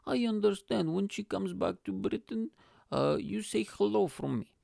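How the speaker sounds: high-pitched put-on dad voice; mock fatherly request in a high voice; dad impression. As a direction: imitate dad, pitched well up. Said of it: "so he goes".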